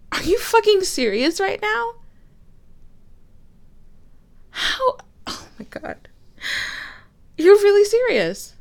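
incredulous, pitch jumping up; can't believe what they just heard; stunned disbelief. Acted. Angry partner in a fight.

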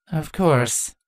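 flat, bored echo; deadpan. A narrator's reading. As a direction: say it dry and unimpressed. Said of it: "Chrysalis repeated in a deadpan voice".

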